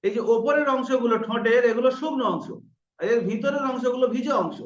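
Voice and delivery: ordinary talk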